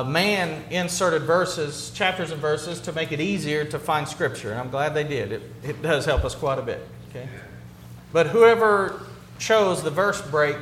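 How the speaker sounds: usual speaking voice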